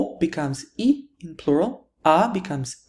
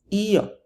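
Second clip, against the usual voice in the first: "io" higher, in two syllables, EE-oh; stressed i; 5 semitones higher than usual. Shown as a demonstration. Teacher repeating the stressed form, "io" said EE-oh. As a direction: lean on the I of "io". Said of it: "emphasis actually falls on that I".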